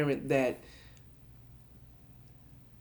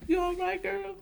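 put-on high, sweet "you all right girl"; mock caring voice; 4 dB quieter than usual. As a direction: coo it like an auntie. Comforting a new mom.

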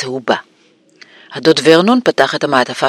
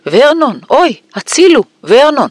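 high-pitched cries for help; choking panic; 11 semitones higher, 3 dB louder than usual. A narrator's reading. Aunt shocked by the letter calls her husband, high choked panic. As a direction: cry out as if about to faint. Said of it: "והשמיעה קולות של חנק".

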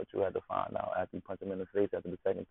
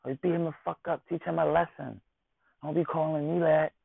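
high, urgent egging on; a girlfriend urging him to fight; 10 semitones higher, 3 dB louder than usual. Retold or imitated. Offended girlfriend urging her man to beat the guy up, high and urgent. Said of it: "She gonna want you to".

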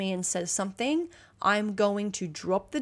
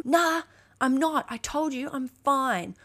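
put-on high, stubborn child brushing mum off; mock defensive child, pitched up; defiant kid; 5 semitones higher, slower than usual. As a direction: whine it up high like a sulky kid.

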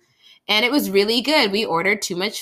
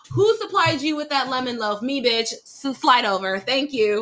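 high, sassy bragging; cocky swagger; 6 semitones higher than usual. Retold or imitated. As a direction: strut to the front of the line. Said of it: "in my mind, I'm like".

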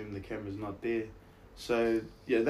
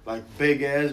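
big theatrical delivery; 5 semitones higher, 11 dB louder than usual. Shown as a demonstration. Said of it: "acting as if it was theatre".

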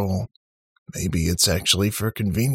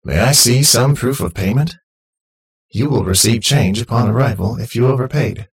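loud, higher, upbeat machine voice; cheerful automated voice; 5 semitones higher, 6 dB louder than usual. A narrator's reading. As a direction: greet them like a chirpy robot host. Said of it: "an automated, cheerful voice".

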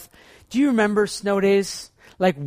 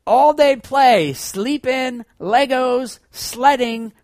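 higher, louder, slow at first, gleeful; kid's excitement; 8 semitones higher, 8 dB louder, much slower than usual. Retold or imitated. Child imagining a snow day of play, excited. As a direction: list the treats like a thrilled child. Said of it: "I'm like".

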